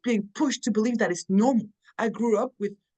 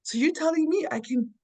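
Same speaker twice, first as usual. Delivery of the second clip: high, wide-eyed wonder at feeling safe; amazed disbelief; 6 semitones higher than usual. Retold or imitated. Retelling her amazement at a safe relationship, high and astonished. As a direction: marvel at it like a brand new discovery. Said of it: "It's like".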